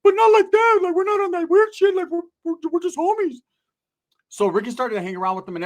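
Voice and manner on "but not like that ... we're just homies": high falsetto, defensive and fast-talking; Ricky's high voice. Retold or imitated. Ricky insisting they are just friends, in a high falsetto. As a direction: squeak out a nervous denial. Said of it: "Ricky was all infatuated".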